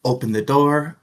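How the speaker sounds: ordinary talk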